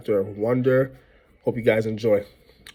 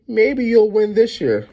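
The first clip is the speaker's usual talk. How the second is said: joking; 10 semitones higher, 3 dB louder than usual. A narrator's reading. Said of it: "I joked".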